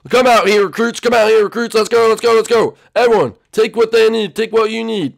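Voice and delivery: loud, high rallying shout; commander calling out. Acted. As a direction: call the troops out loudly. Commander calling recruits out to hunt.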